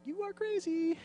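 very high, soft comic squeal; hammed-up tiny high voice; mock disbelief. Acts Matthew reacting to Peter.

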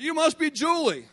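high, loud retold hello; effusive greeting. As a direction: play the wife gushing at a newcomer. Wife's greeting acted again.

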